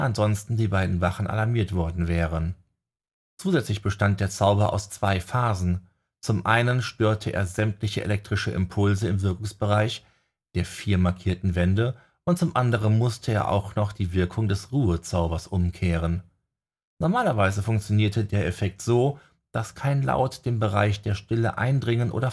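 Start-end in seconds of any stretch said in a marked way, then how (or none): none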